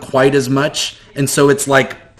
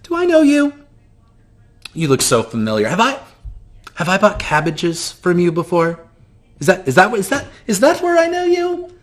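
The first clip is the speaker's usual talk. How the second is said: high, gushing theatrical voice; flamboyant shopkeeper; 7 semitones higher than usual. Acted. GM greeting the player as eccentric shopkeeper, high and gushing. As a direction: gush over the stranger, pitched way up. Same NPC squinting through monocle.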